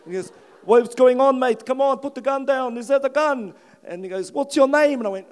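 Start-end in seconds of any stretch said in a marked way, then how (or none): 0.6-3.5 put-on loud, high-pitched South African accent; South African cop. South African cop tells man to drop gun, loud urging. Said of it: "He's South African". 4.3-5.0 loud, raised South African question; South African cop. Retold or imitated. South African cop asks the man his name, calling out. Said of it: "He's South African".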